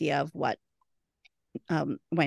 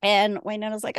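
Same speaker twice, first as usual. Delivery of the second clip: ordinary talk, nothing marked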